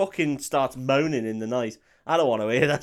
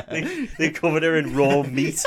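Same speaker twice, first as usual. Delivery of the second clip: laughing; 4 dB louder than usual. Real, not acted. Laughing joke.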